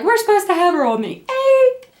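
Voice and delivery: high-pitched wail, stretched at the end; hammed-up tearful whine; mock crying. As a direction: bawl the date in protest. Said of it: "I started crying".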